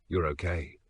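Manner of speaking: quiet hoarse reassurance; soft rough voice, gently. Acted. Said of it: "he said, voice hoarse".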